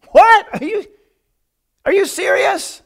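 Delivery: shocked high squeal of disbelief; incredulous. Retold or imitated. Couple hearing the Bible's rule, stunned and incredulous.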